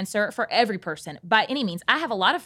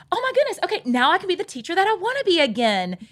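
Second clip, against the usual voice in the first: much higher, gushing mock relief; 10 semitones higher than usual. Host mocking the idea that medication fixes everything, high and gushing. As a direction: parody the idea of an instant cure.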